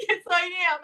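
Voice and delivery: high, squeaky yes I am; a hint of laughing through tears; high and pinched, half laughing; mock tearful admission. Admits sadness, laughing through tears.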